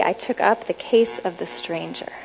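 whispered close of the scripture verse; hushed reading. Acted. Reading scripture to the audience, ending in a whisper. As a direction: drop to a whisper on the last line.

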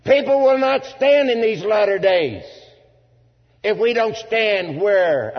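loud, high-pitched pulpit proclamation; full-force preaching. Real, not acted. Preacher warning the congregation people will not stand, full-force preaching. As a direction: drive each phrase home like a fired-up preacher.

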